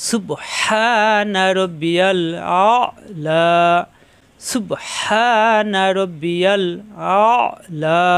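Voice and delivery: very slow, drawn-out Arabic recitation. Shown as a demonstration. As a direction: stretch each sound of সুবহানা, pressed হা. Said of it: "অ কণ্ঠনালীতে চাপ দিতে হবে". (ধীরে টেনে তেলাওয়াত)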